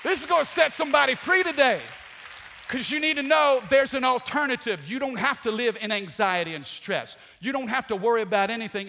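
loud, high, driving preacher voice; full-force preaching. Acted. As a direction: preach it hard to the crowd. Preacher building to a peak.

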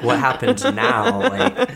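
giggling through a quoted question; real laughter over a retold line; laughing. Real, not acted. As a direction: laugh while quoting the friend. Quoting friend while laughing.

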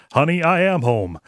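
cheery sing-song homecoming; sitcom dad arrival. Retold or imitated. Voicing the character arriving home.

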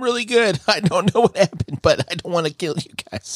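put-on high, girlish plea, half laughing; mock pleading. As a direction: imitate a helpless victim in a high voice. Possessed girl pleads with slayers.

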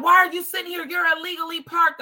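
imitating a harsh policewoman shouting; angry policewoman. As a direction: snap at the driver like an irritated cop. Retold policewoman scolding.

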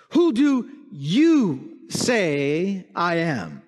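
"you" leaned on, slow; stress on you. Acted. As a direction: hit the word "you" in "Who do you say I am". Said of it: "the emphasis is on the word you".

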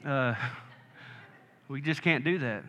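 a hint of a kind pastor saying no; Pastor Paul. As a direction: lightly voice the old pastor. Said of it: "like Pastor Paul used to say".

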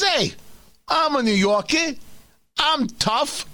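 put-on high-pitched tough New Yorker accent; scumbag New Yorker voice. Radio host mimics Cuomo as tough guy, scumbag New Yorker voice. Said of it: "scumbag New Yorker voice".

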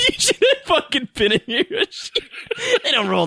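high, laughing through the words. Real, not acted. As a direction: crack up while saying it. Laughing at friend.

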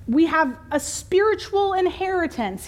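a touch of pulpit grandeur; lofty preacher. Acted. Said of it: "nobody but preachers talks like this".

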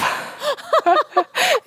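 squealing laugh; bursting into high laughs; high laughter. Real, not acted. Laughing at her own typo.

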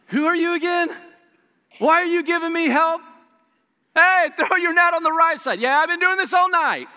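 high, loud put-out fisherman voice; irritated fisherman. Pretend. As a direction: whine back at the stranger on the shore.

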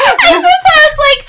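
high, loud burst of excitement; excited outburst. Real, not acted. Excited outburst about the episode.

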